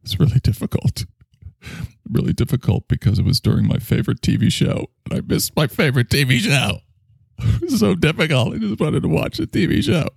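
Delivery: mock whine. Whining about missed TV show.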